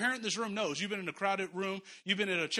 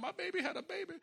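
high, softer tearful imitation; mock crying; 8 semitones higher, 6 dB quieter than usual. Mom in the hospital hallway after the birth, mock crying. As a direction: imitate an overjoyed mother crying, pitched well up. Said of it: "and she's crying".

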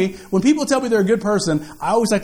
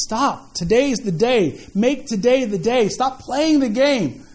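preaching appeal; 5 semitones higher than usual. Acted. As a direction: drive the call home from the pulpit.

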